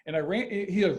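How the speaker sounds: ordinary delivery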